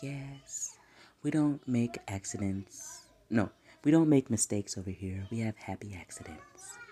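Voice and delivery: calm, collected and hushed; soft-spoken reassurance, dropping to near whisper; Bob Ross. Retold or imitated. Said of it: "He's so calm and collective".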